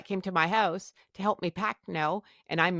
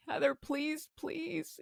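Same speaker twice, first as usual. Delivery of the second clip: softer, begging please; soft pleading; 7 semitones higher, 9 dB quieter, slower than usual. Retold or imitated. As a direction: plead quietly with her to stay. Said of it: "my husband is pleading with me".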